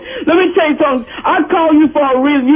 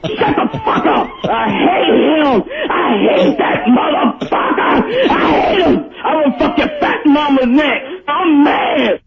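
furious rant. Real, not acted. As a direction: roar it out, hating every word.

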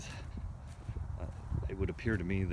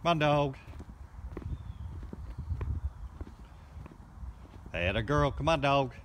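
shouting over wind; 8 semitones higher than usual. Real, not acted. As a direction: call the dog over the wind noise. Calling dog over wind.

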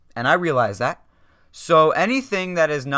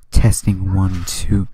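lower, louder mock baritone; sexy baritone voice; 6 semitones lower, 3 dB louder, much slower than usual. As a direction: play the sexy deep radio host. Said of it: "my sexy baritone voice".

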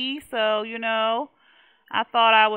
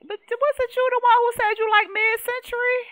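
indignant protest; 13 semitones higher, 6 dB louder than usual. Real, not acted. Arguing with sim.

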